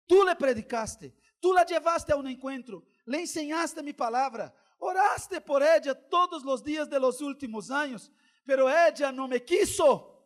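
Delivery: loud, forceful divine rebuke; God's voice shouting the charge; booming voice of God. Retold or imitated. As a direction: thunder it like God speaking down.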